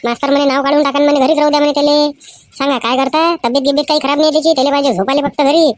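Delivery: mother complaining to her husband about the teacher's call. Acted. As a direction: tell the husband off about the boy.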